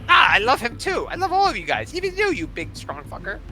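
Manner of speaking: high, loud adoring gush; shower them with love; gushing king. Acted. Kobold king gushing over the whole party.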